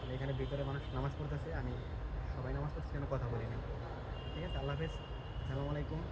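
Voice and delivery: soft, subdued goodbye near worshippers; hushed sign-off. Real, not acted. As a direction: keep the farewell quiet, people are praying. Signing off among people praying. (নামাজিদের পাশে নিচু আওয়াজে বিদায় নেওয়া)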